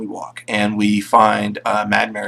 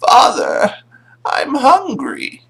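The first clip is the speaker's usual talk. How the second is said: high, drawn-out creature voice; creepy possessed son; 13 semitones higher, much slower than usual. Acted. Possessed son calls to father from below floorboards, creepy plea. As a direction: call up eerily high, slowly.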